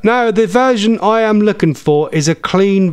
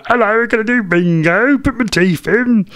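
granny voice; 6 semitones higher than usual. Pretend. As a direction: put on a doddery granny. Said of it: "Sounds like a granny's day out".

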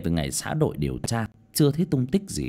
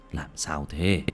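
put-on old husband; 6 semitones lower than usual. Old husband asking his wife about her nightmare, lower voice.

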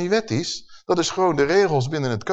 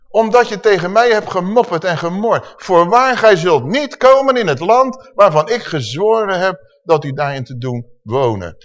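loud, high scripture proclamation; thundering judgement; 4 semitones higher, 6 dB louder than usual. Acted. Preacher reads God barring them from the land, loud and forceful. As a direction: declare the verdict with full force.

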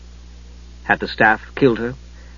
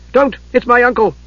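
urgent cry; 11 semitones higher than usual. Acted. Palin stopping Steele drawing his sword, urgent high cry.

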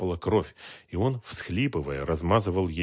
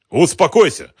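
loud, raised-pitch order; shouted command; 11 semitones higher, 12 dB louder than usual. Acted. Man wresting the gun from her, loud command. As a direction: order her firmly to calm down.

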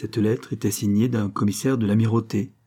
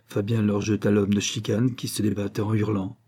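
plain reading voice

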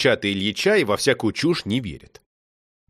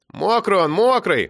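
teasing echo; 7 semitones higher than usual. A narrator's reading. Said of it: "подхватил Мишка".

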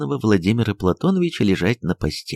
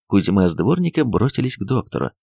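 ordinary delivery, nothing marked

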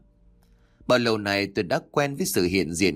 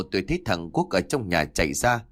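ordinary delivery

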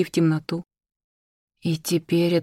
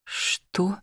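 hoarse; 8 dB quieter than usual. Acted. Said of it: "вырвалось хриплое".

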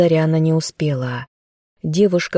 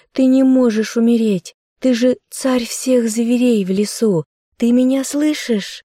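higher, frantic yelling; hysterical shout; 7 semitones higher than usual. Acted. Hysterical girl yells at her dead bear. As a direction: beg him in a panic to wake. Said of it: "У девушки началась истерика".

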